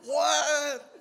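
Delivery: high loud laughing what; shocked what. Retold or imitated. Hearing they must screen sand, loud shocked what. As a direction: blurt it out in shock.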